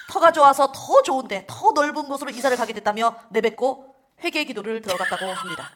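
news anchor. Acted. Parody news read.

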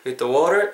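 "with the water" sliding by like a snake over a wall; smoothly gliding pronunciation. Shown as a demonstration. Teacher showing natural flow, gliding "with the water". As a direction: slur "with the" smoothly into a stressed "water". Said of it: "구렁이 담 넘어가듯이".

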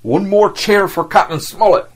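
rally the crew with a shout; ringing call to cheer the captain; rousing cry. Acted.

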